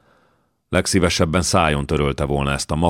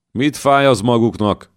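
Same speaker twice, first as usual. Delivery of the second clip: irritated, higher and louder retort; a son's higher, louder annoyed shrug; 7 semitones higher, 4 dB louder than usual. A narrator's reading. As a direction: snap back at the old man. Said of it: "vont vállat Jankó bosszusan".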